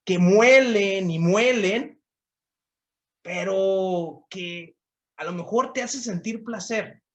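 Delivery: preaching to the listener. Acted. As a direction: press each question home to the congregation. (predicación lenta y reflexiva, preguntando al oyente)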